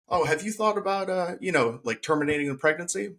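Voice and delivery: high-pitched, offhand imitation of a doctor; airy mock-casual suggestion.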